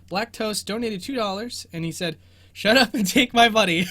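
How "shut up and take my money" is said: loud, laughing through the words. Real, not acted. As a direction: read it while cracking up. Reading donation, laughing.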